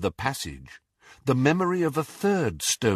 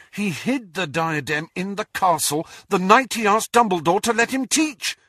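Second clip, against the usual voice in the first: higher-pitched, eager working-out; excited realisation; 8 semitones higher than usual. A narrator's reading. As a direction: think aloud as it dawns on you. Harry excitedly works out the clue.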